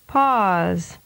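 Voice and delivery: "Pause." with the vowel drawn out long. Shown as a demonstration. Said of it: "and then with a longer duration".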